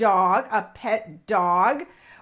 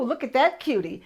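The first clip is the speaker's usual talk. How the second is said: ordinary talk, nothing marked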